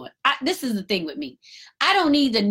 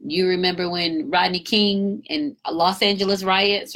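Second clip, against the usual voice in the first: ordinary delivery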